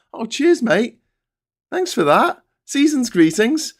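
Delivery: high, sarcastic mock thanks; squeaky fake gratitude; mock cheery thanks. Mock dialogue reply.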